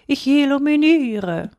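high, slow, drawn-out cat voice; cat calling down. A narrator's reading. Cat answers boy from the tree, drawn-out call. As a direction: stretch the word out high like a cat. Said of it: "rief die Katze herunter".

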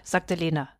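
ordinary delivery

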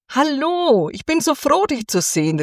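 high, bright-eyed greeting called up to a bird; calling out gladly. A narrator's reading. Thumbelina calls to the returning swallow, high and overjoyed. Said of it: "rief Däumelinchen ihr zu".

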